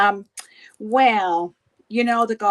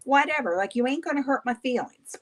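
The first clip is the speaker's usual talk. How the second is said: usual speaking voice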